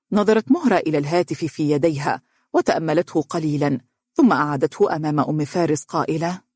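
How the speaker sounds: plain reading voice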